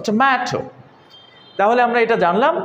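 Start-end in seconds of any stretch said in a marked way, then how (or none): 0.1-0.6 টমেটো with a long "maay" in the middle; lengthened middle syllable. Shown as a demonstration. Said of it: "ম্যাটা একটু লম্বা হচ্ছে". (টমেটো-র মাঝের মে টেনে লম্বা করে)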